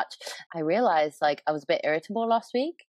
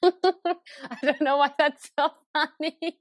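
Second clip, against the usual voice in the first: high, squeaky through laughter; laughing helplessly; 8 semitones higher than usual. Real, not acted. Giggling at a friend's crying story, laughing helplessly. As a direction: barely get it out for laughing.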